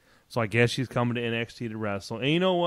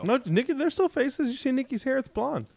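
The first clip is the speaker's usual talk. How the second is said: mock Nikki voice. Mocking Nikki's voice.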